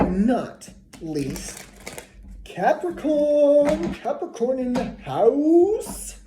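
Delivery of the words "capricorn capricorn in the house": high, slow, drawn-out call of the sign; hyped announcer call. Acted. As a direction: announce it like a ring entrance. Big announcement of the last sign.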